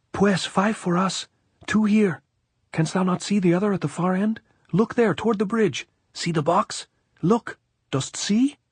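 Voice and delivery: very high, eager lookout voice. A narrator's reading. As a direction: point out each one in a high, urgent voice. Reports the dead, pointing.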